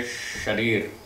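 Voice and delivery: शरीर said slowly with a clear श sound; careful pronunciation. Shown as a demonstration. Teacher showing students how to say शरीर, slow and careful. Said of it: "जैसे एक शब्द है".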